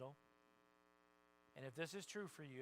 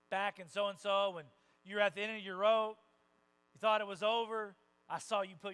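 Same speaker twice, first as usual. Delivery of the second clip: rousing praise; 4 semitones higher, 11 dB louder than usual. Acted. God praising a believer's faith.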